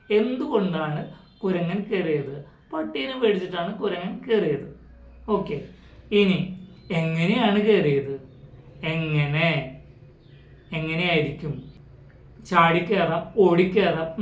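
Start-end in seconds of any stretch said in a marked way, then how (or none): none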